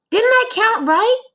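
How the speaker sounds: put-on high, loud protesting kid; child Joe. Boy insists to his teacher he counted right, high and indignant.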